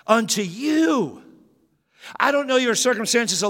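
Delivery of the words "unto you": "Unto you" slow, higher, with "you" hit; stress on you. Real, not acted. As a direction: lean on "you". Stressing personal gift.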